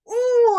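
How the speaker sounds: high, loud "ooh" of catching yourself; a sudden high, loud exclamation in place of snapping back; retold "ooh". Mom catching herself before answering her son, high exclaimed ooh.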